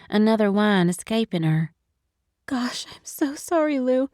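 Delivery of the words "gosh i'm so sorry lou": tearful whisper. Acted. Sobbing friend apologizing.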